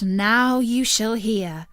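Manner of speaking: ordinary delivery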